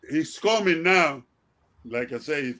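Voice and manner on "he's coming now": loud, slow warning call; retold yell. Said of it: "he yelled at me and say".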